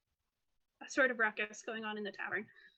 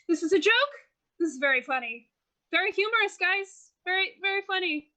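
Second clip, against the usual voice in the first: raised, higher voice thrown over the shoulder; yelling behind him; 5 semitones higher, 5 dB louder than usual. Acted. Man yells into the tavern at suspected pranksters, mildly yelling. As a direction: call it out to mates in the other room. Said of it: "like, yelling into the tavern behind him".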